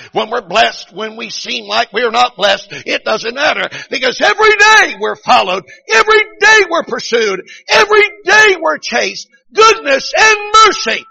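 climbing revival cadence, ending very high and loud; fired-up preaching. Retold or imitated. Preaching building to full force.

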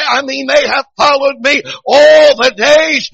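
loud, high, drawn-out pulpit shout; fired-up preaching. Real, not acted. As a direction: build to a stretched revival cry. Preaching at full force.

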